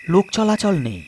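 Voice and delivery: ordinary delivery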